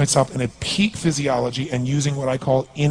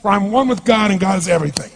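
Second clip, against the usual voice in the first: emphatic, raised declaration; fervent incantation; 3 semitones higher than usual. Acted. Coach re-enacting his wealth incantation for the audience, fervent declaration. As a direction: proclaim it with total conviction. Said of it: "I do another one".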